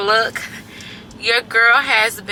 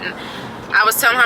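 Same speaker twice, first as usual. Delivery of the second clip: normal talking voice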